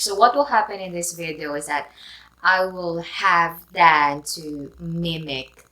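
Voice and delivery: ordinary talk, nothing marked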